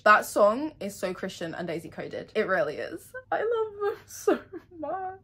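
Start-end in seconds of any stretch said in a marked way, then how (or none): 3.3-5.2 high, breaking tearful voice; crying. Real, not acted. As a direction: sob it out lovingly. Crying over beloved characters.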